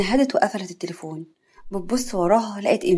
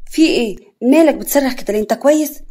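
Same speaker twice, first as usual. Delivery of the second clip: louder, higher worried questions; acted alarm, pitched up; alarmed concern; 4 semitones higher, 6 dB louder than usual. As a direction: burst in asking, voice climbing. Runs in alarmed at his scream.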